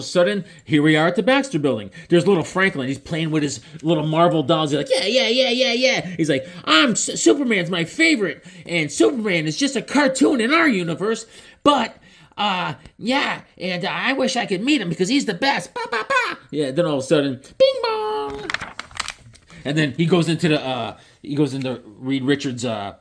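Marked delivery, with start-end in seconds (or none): 4.9-16.4 put-on high, chirpy child voice; little kid voice. Franklin gushing over his Superman doll, high kid voice. 17.6-18.5 high sung bing bong; doorbell imitation. Announcing someone at the door with a high chime.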